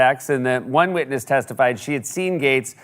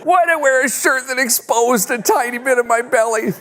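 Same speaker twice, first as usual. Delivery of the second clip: put-on whiny left-out kid; 13 semitones higher, slower than usual. Left-out kid begging the quarterback Chad for the ball, shrill and pleading. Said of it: "like the kid playing backyard football who never gets the ball".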